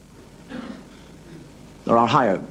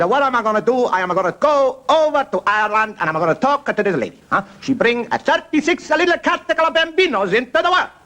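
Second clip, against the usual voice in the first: high, hammed-up Italian accent; excitable comic pontiff with a mock Italian accent; Holy Father; 12 semitones higher than usual. Pope deciding to go to Ireland, mock Italian accent. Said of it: "That's the way he talks".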